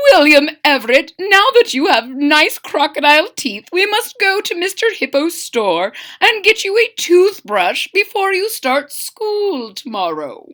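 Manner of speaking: high, loud, loving mother calling her son; a doting mama with a lifted voice; Mama crocodile voice. Acted. Mama crocodile speaks fondly to her son about toothbrushes.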